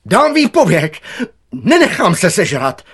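high, very loud, panicked protest; gardener yelling. A narrator's reading. Gardener up the tree threatens to quit, yelling. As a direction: holler it in outraged alarm. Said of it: "volal zahradník".

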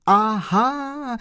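high, bright exclaimed aha; joyful cry. Acted. Kolbaba realising who the chauffeur is, crying out joyfully. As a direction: cry out in sudden joy. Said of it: "vykřikl pan Kolbaba radostně".

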